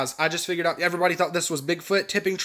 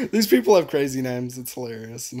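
usual speaking voice

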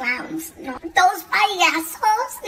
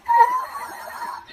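mock shriek. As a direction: squeal it suddenly into his ear. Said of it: "She was like".

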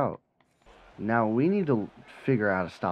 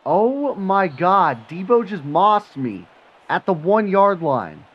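exasperated outburst; 8 semitones higher, 9 dB louder, slower than usual. Real, not acted. Opponent scores on a catch.

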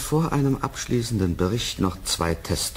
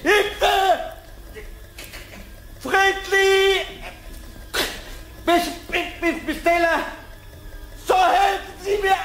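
terrified screaming for help; slower than usual. Acted. Scientist attacked in lab, shrieking for help, terrified. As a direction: scream for your life as something grabs you.

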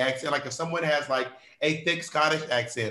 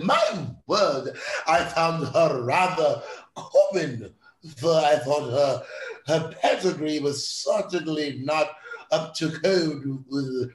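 very slow, hammed-up upper-class English accent; posh British parody; slower than usual. Aristocrat judging a woman's pedigree, posh British parody. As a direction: draw out the vowels like a snooty British aristocrat. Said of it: "is there anyone who actually speaks that way?".

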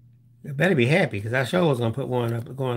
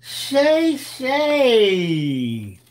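singsong echo; 7 semitones higher than usual. Acted. Echoing name in singsong.

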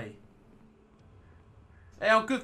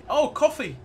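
excited; 10 semitones higher, 9 dB louder than usual. Real, not acted. Finally finds coffee in game.

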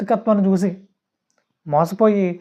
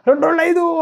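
demonstrated shouting; 10 semitones higher than usual. Shown as a demonstration. Preacher showing that shouting doesn't make truth, mock shout. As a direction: call out the false sum with raised pitch. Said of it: "అని గట్టిగా అరిచాను అనుకోండి".

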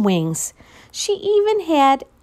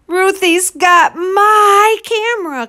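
louder, higher tattling cry; complaining cry; 8 semitones higher, 6 dB louder than usual. Acted. Martin crying out to Missus Olson about the camera, loud. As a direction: complain to the teacher. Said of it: "cried Martin".

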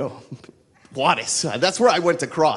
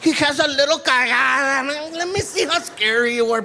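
ordinary talk

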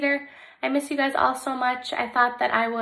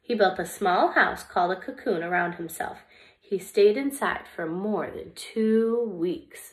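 lively, expressive storybook reading; reading to children; 3 semitones lower, 3 dB louder than usual. Acted. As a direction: read it like story time for little kids. Reads picture book to children.

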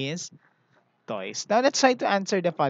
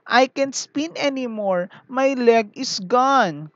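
crying top; 6 semitones higher, 5 dB louder, slower than usual. Acted. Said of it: "cried the top".

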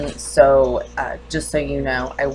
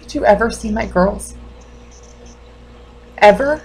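raised, higher-pitched accusing questions; bereaved mother confronting doctor; 5 semitones higher, 8 dB louder than usual. Acted. Mother demands whether doctor saw her life go to hell, accusing. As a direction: challenge the doctor with rising hurt and anger.

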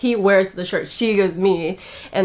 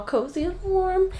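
cooing; 10 semitones higher, 5 dB quieter, slower than usual. Real, not acted. Cooing over soft blanket.

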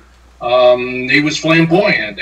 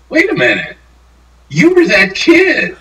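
surprised recognition; 11 semitones higher than usual. Retold or imitated. Old star realizing the fan is that kid, astonished. Tommy recognizing him, retold.

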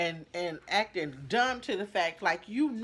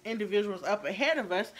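ordinary talk

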